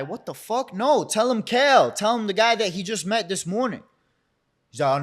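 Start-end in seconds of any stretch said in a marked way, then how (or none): 0.0-3.8 exasperated protest. Retold or imitated. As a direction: insist on your name in disbelief. Indignant protest at mistaken identity.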